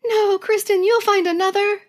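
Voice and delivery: high, sympathetic friend voice; lightly voiced comforting classmates; consoling friends.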